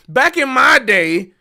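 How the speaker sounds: put-on slow, drawn-out old-timer. Lightly voiced old-timer complaining about YouTube. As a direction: play the grumpy old jazzman.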